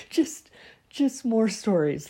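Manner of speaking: high laughing words; real amused laughter. Real, not acted. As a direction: giggle through it. Laughing about more stories.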